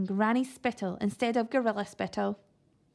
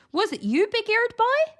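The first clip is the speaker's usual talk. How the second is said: high, loud posh bark; high loud aristocrat bark; barking toff; 11 semitones higher, 6 dB louder than usual. A narrator's reading. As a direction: bark in the boy's face. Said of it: "he barked".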